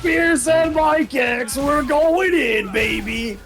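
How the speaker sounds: high, drawn-out match introduction; hype announcer. Real, not acted. Commentator introducing grand finals to viewers, hyped.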